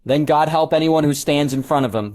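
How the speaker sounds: ordinary delivery, nothing marked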